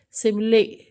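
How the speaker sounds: सिमले in two beats, ending in a "lay" sound; final E sounded. Shown as a demonstration. Teacher gives students an example, सिमले with final E sounded. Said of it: "इस example में".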